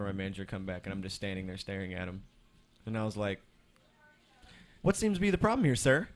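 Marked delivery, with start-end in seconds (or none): 4.8-6.1 bright, much higher, louder service voice; customer service voice. Retold or imitated. Worker greeting the angry customer, fake-sweet service voice. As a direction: put on the fake-polite counter voice. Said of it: "With my best customer service face".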